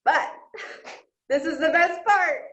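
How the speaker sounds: real choked-up excitement; excited through tears. Real, not acted. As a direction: burst out with teary joy. Tearful joy recounting good run.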